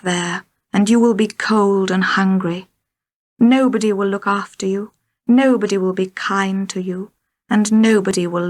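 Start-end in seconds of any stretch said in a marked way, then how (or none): none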